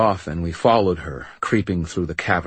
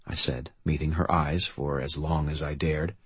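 plain reading voice

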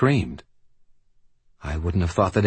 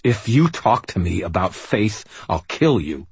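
angry threat; 3 semitones higher than usual. Acted. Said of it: "Anger and frustration suddenly burned hot".

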